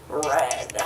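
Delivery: hushed breathy whisper. Acted. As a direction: drop to a secret whisper. Dramatic reveal after pause.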